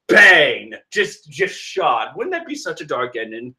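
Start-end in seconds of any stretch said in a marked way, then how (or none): 0.1-0.7 mock gunshot. Imitating a gunshot.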